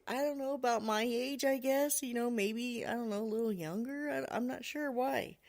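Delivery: lightly voiced puzzled son; unsure kid. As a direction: imitate a boy guessing at an answer. Boy answers dad's puzzling question.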